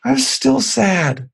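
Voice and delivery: put-on high, very slow mopey child voice; sad little girl. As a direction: drag it out like a sulking little girl.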